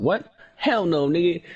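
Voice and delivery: high, loud imitation of mom; mock mom outrage. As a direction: squeal it like a shocked mom. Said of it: "My mom was like".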